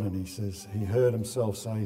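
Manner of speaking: usual speaking voice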